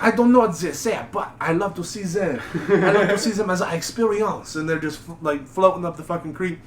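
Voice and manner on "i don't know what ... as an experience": mock French accent, laughing through it; a cheerful, clueless Frenchman waving; French guy. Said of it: "the French guy's like".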